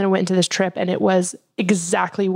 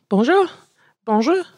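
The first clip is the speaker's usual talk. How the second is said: tentative mock French greeting; practicing French greeting; 5 semitones higher than usual. Practicing nervously before going into a Paris store, French greeting. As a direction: rehearse a French "bonjour", rising at the end. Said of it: "practice, like, before I would go into a store".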